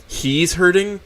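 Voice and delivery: disbelieving echo, held in; stunned disbelief. Acted. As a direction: say it as if you can't believe it.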